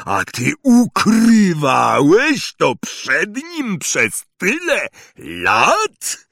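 loud, high, slow accusing giant; furious shout. Acted. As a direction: rage at the uncle. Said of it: "każda sylaba drgała wściekłym gniewem".

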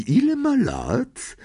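high, slow appraising question; drawn-out query. A narrator's reading. Witch examining a customer's old rat, slow high-pitched question. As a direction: ask it slowly, pitched well up.

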